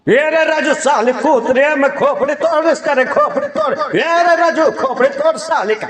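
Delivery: high, frantic comic ranting; Paresh Rawal. Acted. Comic character scolding Raju over his car, Paresh Rawal impression. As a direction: rant high and nonstop, hammed-up outrage. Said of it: "Paresh Rawal जी का आगे कहते हैं".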